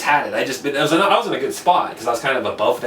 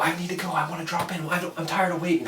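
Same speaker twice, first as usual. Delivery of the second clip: a higher, softer mock plea, lightly teary; imitating crying girlfriend; 5 semitones higher, 8 dB quieter, faster than usual. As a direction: lightly imitate her whiny, tearful begging to drop in. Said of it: "she's crying".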